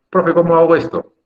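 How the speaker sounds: high, loud pupil calling the teacher; student's question. Acted. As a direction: call out like a student needing help. Acting a student asking the teacher.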